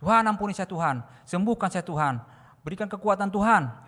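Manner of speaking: loud, high plea in prayer; demonstrated cry to God. Shown as a demonstration. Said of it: "terus berteriak".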